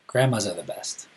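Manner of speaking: ordinary delivery, nothing marked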